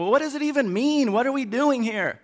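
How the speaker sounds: high-pitched, bewildered protest; mock exasperation. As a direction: throw up a confused, exasperated question. Exasperated onlooker of the debate.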